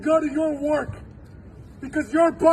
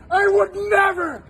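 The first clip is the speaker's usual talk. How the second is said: ordinary delivery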